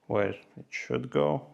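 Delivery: ordinary talk, nothing marked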